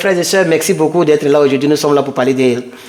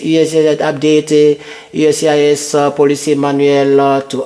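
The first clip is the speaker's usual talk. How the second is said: monotone, even reading of an official notice; measured article reading on a level pitch; read-aloud; 2 semitones lower than usual. Acted. Reads official update from screen.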